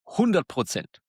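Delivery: ordinary delivery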